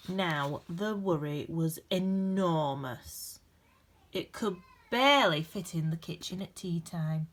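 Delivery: gentle, expressive storybook reading with dramatic pauses; reading to children. A narrator's reading. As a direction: read it like a bedtime picture book for a class. Reads picture book aloud.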